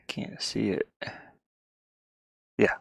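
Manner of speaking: ordinary delivery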